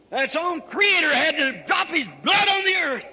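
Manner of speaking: ordinary delivery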